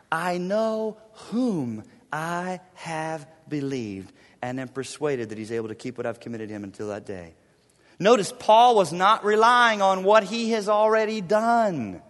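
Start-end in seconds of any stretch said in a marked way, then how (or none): 0.1-7.3 solemn verse reading. Acted.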